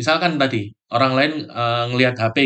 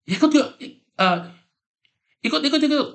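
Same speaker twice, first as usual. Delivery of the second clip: much higher, eager repeated "ikut, ikut, ikut"; excited yes; faster than usual. Acted. Friend accepting a holiday trip invite, eagerly excited. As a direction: jump at the invite, bubbling over. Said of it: "Oh, dia excited".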